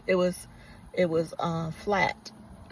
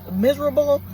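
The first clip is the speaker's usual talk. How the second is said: miserable said much higher and louder; emphatic repeat; 8 semitones higher, 9 dB louder than usual. Real, not acted. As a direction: punch the repeated word miserable hard.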